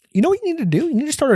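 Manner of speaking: mock someone handing out smug advice; mock advice giver. Mocking people who tell him what to do, high and sing-song.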